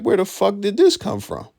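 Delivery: high, incredulous what-the-hell; mock disbelief. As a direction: act stunned at the sudden accent. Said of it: "I'm like".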